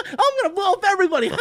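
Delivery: a cartoon villain cackling his plan; mock villain, laughing. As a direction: rattle it off like a giddy madman. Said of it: "He's running around going".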